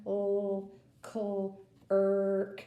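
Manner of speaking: "cl-", "cl-", "erk" split into pieces; sounding out. Shown as a demonstration. Teacher sounding out clerk piece by piece. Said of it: "So we have".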